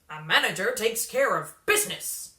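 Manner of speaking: put-on loud, pushy hustler calling a kid over; a slick manager leaning in with a sales pitch; brash showbiz manager. A narrator's reading. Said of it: "said the man".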